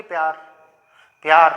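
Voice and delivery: "प्यार" ending on r, no vowel after it; final vowel silent. Shown as a demonstration. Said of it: "नहीं आ रहा ना".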